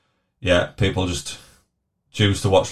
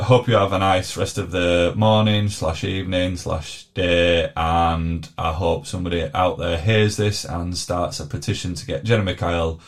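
ordinary talk

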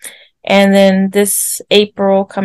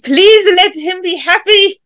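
pleading; 9 semitones higher than usual. Retold or imitated. Sister recalls hoping her brother is happy, pleading. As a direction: plead it as a recalled wish, pitched well up. Retells her anxious wish.

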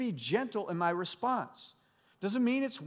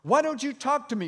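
raised, pressing demand, higher and louder; Pilate demanding; 6 semitones higher, 6 dB louder than usual. A narrator's reading. Pilate presses Jesus, a raised demanding voice. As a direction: demand an answer from the silent prisoner. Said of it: "Pilate demanded".

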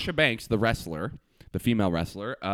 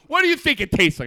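higher, louder exasperated question; imitating annoyed sister; 12 semitones higher, 7 dB louder than usual. Sister quizzing him about ketchup, mock angry. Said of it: "angry, talking to a dumb person".

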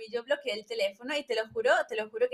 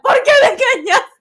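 high, loud wail at the cheater; imitated heartbroken shout over a video call; mock anguished yell; 12 semitones higher, 16 dB louder than usual. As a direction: cry out the betrayal, hammed up. Reenacting confronting cheating boyfriend.

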